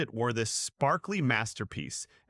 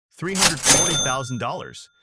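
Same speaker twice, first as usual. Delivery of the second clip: loud announcing; 7 dB louder than usual. Real, not acted. Price announced.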